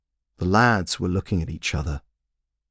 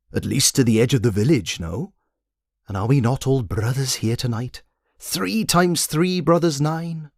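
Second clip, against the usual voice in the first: mystic guide; 6 semitones higher than usual. A narrator's reading. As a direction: intone it like a cryptic sage. Guide's ceremonial speech.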